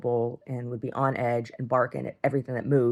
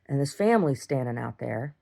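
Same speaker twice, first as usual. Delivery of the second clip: ordinary talk, nothing marked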